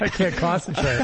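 laughing mock distraction. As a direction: giggle through the quote. Mocking friend's distracted face.

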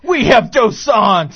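loud, slow hype announcer. Acted. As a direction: sell it like a monster truck ad. Ad announcer parody.

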